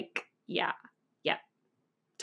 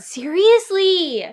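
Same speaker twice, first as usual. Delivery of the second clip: exasperated; 10 semitones higher, 6 dB louder, much slower than usual. Real, not acted. Indignant at Nick.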